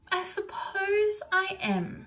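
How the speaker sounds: a hint of a slow old gardener. Old gardener voiced by narrator.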